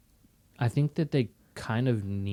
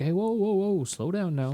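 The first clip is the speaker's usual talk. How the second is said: put-on old man voice; 10 semitones higher than usual. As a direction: imitate a confused old man.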